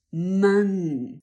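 Man said strongly and held, the main syllable; stressed syllable Man. Shown as a demonstration. Teacher isolates the main syllable Man. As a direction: lean into Man. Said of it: "man jest tą główną sylabą".

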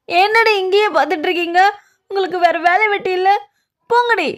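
high-pitched angry shooing; irritated scolding. Acted. Chasing off staring onlookers. (எரிச்சலோடு உயர்ந்த குரலில் விரட்டுவது)

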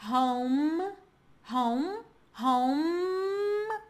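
HOME ending on a held, hummed M; final M sound. Shown as a demonstration. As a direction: close "home" on the M, no vowel after it. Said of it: "som de M".

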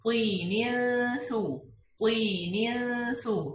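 طين ثو with the tanween hidden under a light nasal hum before ث; light nasal hiding. Shown as a demonstration. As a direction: hide the noon lightly into the ث of طين ثو. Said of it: "with light غنة". (إخفاء بغنة خفيفة في طين ثو)

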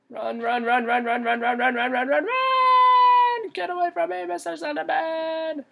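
rapid high chant rising to a squeal; high, frantic squeal at a pursuer; panicked escape. Real, not acted. Fleeing the monster in game.

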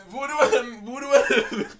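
laughing too hard. Real, not acted. Storyteller trying to tell about Voodoo, cracking up. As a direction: try to get it out through laughter. Cannot finish sentence for laughing.

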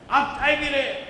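loud, high, chai punched and falling; accusing question. Shown as a demonstration. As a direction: demand it, almost scolding. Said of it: "I said it three different ways".

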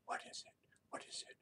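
whispered, suspenseful question; hushed wondering. Retold or imitated. Storyteller asks what the mountain really is, hushed. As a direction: ask very softly, building suspense.

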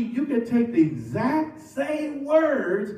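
normal talking voice

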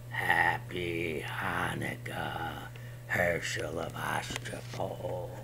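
low, slow eerie whisper; creepy monster whisper; bone-cracking whisper. Acted. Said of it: "sounded like the cracking of bones".

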